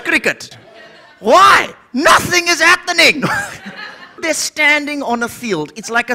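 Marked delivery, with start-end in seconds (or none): 1.2-3.2 loud, exasperated yell about nothing happening; exasperated shout. Acted. Comic ranting at the crowd about cricket, exasperated shout. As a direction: throw up your hands and yell it.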